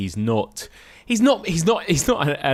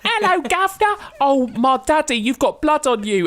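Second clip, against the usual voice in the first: put-on high, loud Cockney child voice; hammed-up little street urchin, pitched way up; Dickensian urchin; 4 dB louder than usual. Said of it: "cherubic urchin from, from a Dickens novel".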